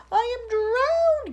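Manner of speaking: high, loud slow gasp. Acted. Grasshopper gasping after the deluge, high and slow. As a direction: gasp out half-drowned. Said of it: "gasped the old green grasshopper".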